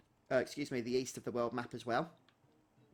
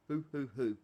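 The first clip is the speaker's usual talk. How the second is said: 1 owlish hoot. Acted. Innkeeper's sign-off hoot.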